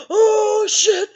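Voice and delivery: loud, high panicked yelp; shrieking in fear; retold panic; panicked cry. Panic at shotgun threat.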